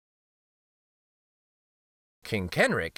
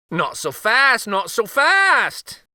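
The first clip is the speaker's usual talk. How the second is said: high, louder, slow playful warning; uncle stopping him; 10 semitones higher, 6 dB louder, slower than usual. A narrator's reading. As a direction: hold him back playfully. Uncle voiced in story.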